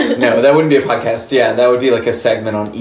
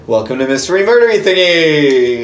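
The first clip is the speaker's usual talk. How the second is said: big, loud, raised-pitch welcome; show announcer; 4 semitones higher, 5 dB louder than usual. Pretend. Host welcoming listeners to the podcast, hammed-up announcer. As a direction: belt out the show title like an announcer.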